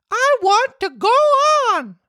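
high insistent puppet voice; insist, high and stubborn; stubborn Pinocchio. Acted.

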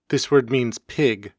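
ordinary talk

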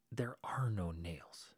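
slow, whispered "There are no nails."; hushed, deadpan reply. Retold or imitated. Local man telling the visitor there are no nails, slow whisper. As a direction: say it quietly and slowly, as if it's obvious.

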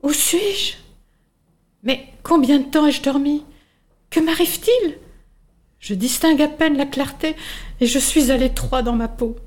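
high, puzzled little caterpillar voice; bewildered caterpillar. Acted. Caterpillar waking in her cocoon, high and bewildered. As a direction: wonder aloud, lost and confused. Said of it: "caterpillar wakes in cocoon".

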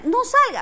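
shrill, high and loud don't; emphatic. Real, not acted. Host summing up her advice to viewers, very emphatic. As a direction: punch the warning. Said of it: "en resumida".

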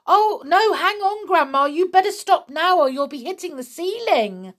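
urgent, high-pitched warning; alarmed shout. A narrator's reading. George warns Grandma off the ceiling, alarmed shout. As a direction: shout a warning, hurrying toward the end. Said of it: "gave a jump and shouted".

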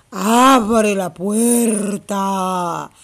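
low, slow big bad wolf demand; lightly voiced wolf, low and dragged out. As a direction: stretch each word like the wolf at the door. Wolf knocks at straw house.

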